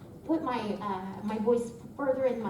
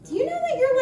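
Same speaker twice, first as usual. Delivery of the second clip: much higher, louder question; retold talent agent; 13 semitones higher, 5 dB louder, faster than usual. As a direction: lift it high as the eager agent asks. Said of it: "she's like".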